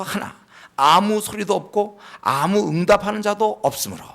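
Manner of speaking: ordinary talk, nothing marked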